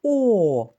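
О held out loud and clear on its own; isolated vowel sound. Shown as a demonstration. Teacher sounds out О for young pupils, held clearly. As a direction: give the bare vowel О, sustained. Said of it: "Наступний звук".

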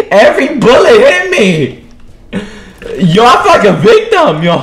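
high, loud laughing complaint; laughing shout. Real, not acted. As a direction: yell it while laughing. Laughing after being shot.

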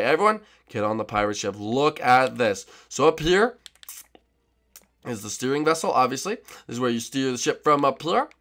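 normal talking voice

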